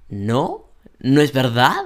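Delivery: loud "no es verdad" climbing at the end; rising intonation. Shown as a demonstration. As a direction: swing "verdad" up at the end as a question. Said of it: "Aquí tienes entonces la entonación que sube".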